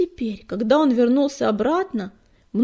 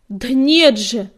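loud, drawn-out protest; emphatic denial; 9 dB louder, slower than usual. A narrator's reading. Fireheart contradicts the doubting cat, insistent. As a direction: insist on it, slow and forceful.

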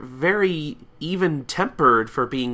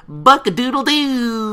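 much higher, louder mock crow; rooster crow; 13 semitones higher, 7 dB louder, slower than usual. Applejack crowing like a chicken, high and loud. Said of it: "Applejack goes".

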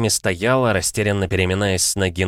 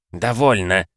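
curt, unraised voice; slower than usual. A narrator's reading. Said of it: "не повышая голоса, бросил человек".

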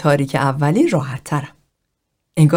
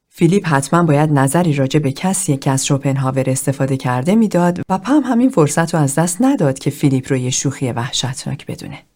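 plain reading voice

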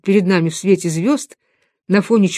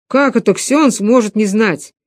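ordinary delivery, nothing marked

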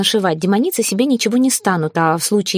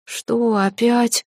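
slow, drawn-out sleepy question, a bit higher; 4 semitones higher, much slower than usual. A narrator's reading. Woken early by her, sleepy. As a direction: drag it out as if just woken. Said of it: "сонно посмотрел на меня".

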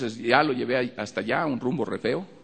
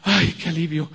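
higher, louder sigh of relief; relieved healed woman; 7 semitones higher, 6 dB louder than usual. Retold or imitated. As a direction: voice her sudden relief. (mujer aliviada, aguda y fuerte)

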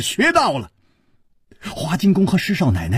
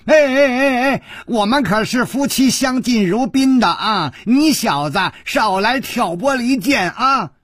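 high, louder call through the window; 6 semitones higher than usual. Acted. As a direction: call out to interrupt, raised and high. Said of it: "大声说".

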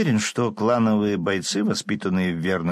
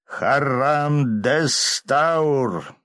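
slow, loud chanted spell; spell incantation; 5 semitones higher, 3 dB louder, much slower than usual. Acted. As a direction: intone the spell with power. Casting a spell.

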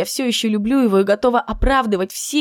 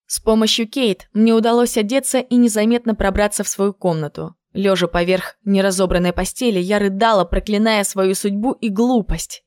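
plain reading voice

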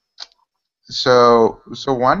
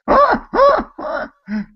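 high honking goose call; 4 dB louder than usual. Retold or imitated. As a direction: honk like an angry goose. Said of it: "like the goose noise".

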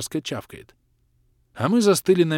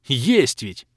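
angry growl; 4 dB louder than usual. Acted. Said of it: "Зло рыкнул я".

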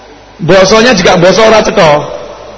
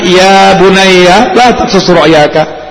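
tender Arabic recitation; 3 semitones lower, much slower than usual. Retold or imitated. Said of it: "Nabi Yakub berkata kepada anaknya".

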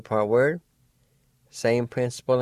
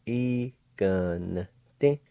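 Iganete drawn out very slowly; slow demo; much slower than usual. Shown as a demonstration. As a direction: stretch Iganete syllable by syllable.

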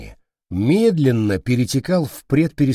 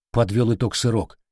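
ordinary delivery

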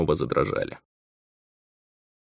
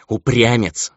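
raised, irritated exclamation; exasperated reproach; starting to get angry; 5 semitones higher, 4 dB louder, slower than usual. A narrator's reading. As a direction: snap it out in rising irritation. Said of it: "начиная сердиться".

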